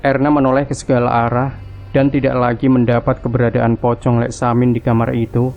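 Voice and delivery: usual speaking voice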